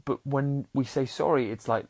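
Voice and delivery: usual speaking voice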